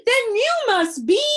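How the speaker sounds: high, loud, slow frightened cry; gasped in fear. Acted. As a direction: cry it out in sudden horror. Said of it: "gasped Milo".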